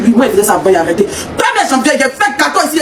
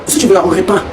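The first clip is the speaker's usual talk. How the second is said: usual speaking voice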